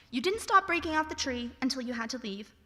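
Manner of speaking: normal talking voice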